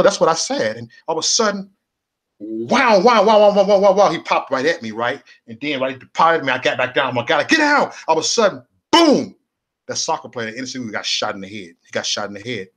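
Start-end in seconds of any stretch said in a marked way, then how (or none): 2.4-4.1 loud, high rapid gunfire noises; spray the shots out loud, fast; imitated gunshots. 8.9-9.3 a loud, high single blast; imitated gunshot.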